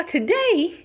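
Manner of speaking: usual speaking voice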